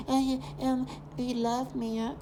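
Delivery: high, drawn-out mock voice; mocking imitation. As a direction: imitate them in a high, stretched-out whine. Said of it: "And you hear this trash".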